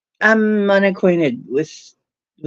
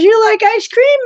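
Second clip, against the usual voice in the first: sudden high, louder question; high bright offer; 5 dB louder than usual. Retold or imitated. Husband turning into ice cream shop, excited high question. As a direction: pitch it up like a sudden treat.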